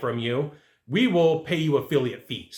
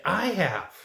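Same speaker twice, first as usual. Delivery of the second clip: bragging influencer; slower than usual. Retold or imitated. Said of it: "the influencer is gonna come back".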